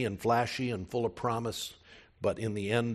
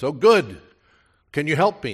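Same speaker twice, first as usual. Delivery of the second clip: relieved; 5 semitones higher, 12 dB louder than usual. Retold or imitated. Man on cliff pleads.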